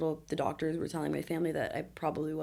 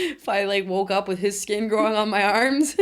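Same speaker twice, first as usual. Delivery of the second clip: laughing; 4 semitones higher, 9 dB louder than usual. Real, not acted. Laughing at grim joke.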